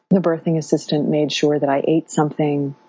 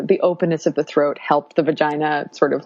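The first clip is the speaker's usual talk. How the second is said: usual speaking voice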